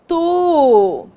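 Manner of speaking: "tu" held long on its own; syllable dictation. Shown as a demonstration. Teacher giving the last dictated syllable, drawn-out dictation. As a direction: dictate "tu" as one stretched syllable. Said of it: "última sílaba que nós ditamos".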